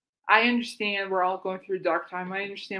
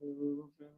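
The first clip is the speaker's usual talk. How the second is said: mock growl; 5 semitones lower, 14 dB quieter than usual. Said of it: "I'm like".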